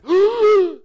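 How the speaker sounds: loud, high acted gasp; desperate gasp for air. Student hauled out of the river, struggling for breath, loud desperate gasp. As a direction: gasp like someone hauled up from underwater. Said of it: "And you hear this".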